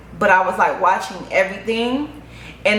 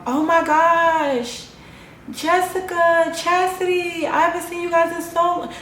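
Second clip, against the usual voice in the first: happy and warm; 9 semitones higher than usual. Retold or imitated. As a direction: gush as if seeing old friends after years. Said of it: "I was really just happy and just warm".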